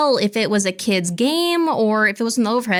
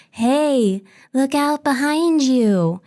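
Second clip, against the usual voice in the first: put-on higher, slow eerie child singsong; little girl's voice; 4 semitones higher, much slower than usual. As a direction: imitate a creepy little girl, drawn out. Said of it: "I hear a little girl's voice from the overhead speaker".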